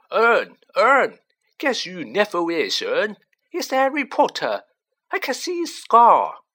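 loud, high, thrilled cockney calling out; bursting with the news to his mate; gleeful shouting. A narrator's reading. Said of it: "Stan shouted gleefully".

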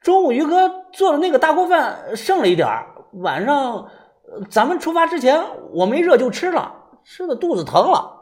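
high-pitched flustered explaining; anxious. A narrator's reading. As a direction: plead your excuse urgently. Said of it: "着急地指着于哥说道".

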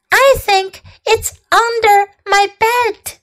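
put-on high-pitched frightened child voice; scared little girl. A narrator's reading. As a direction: tell your parents like a scared little girl. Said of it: "said Amanda".

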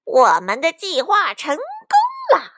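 loud, high, drawn-out villain crowing; weird gloating villain. A narrator's reading. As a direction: gloat like a bandit chief. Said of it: "强盗巴巴怪声笑道". (强盗头子怪声怪气地得意)

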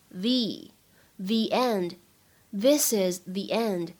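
slow "the" said "thee" before "end"; 慢读the end，the读作thee. Shown as a demonstration. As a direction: say "the" as "thee" before the vowel, slowly. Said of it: "定冠词我们应该读成".

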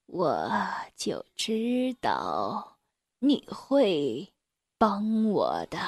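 put-on very slow, low, quiet old elephant; low, drawn-out elder's voice; old elephant character. A narrator's reading. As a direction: speak as a weary old elephant, slow and hushed. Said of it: "他对老鼠妹妹说道".

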